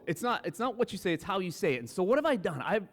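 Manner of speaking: ordinary delivery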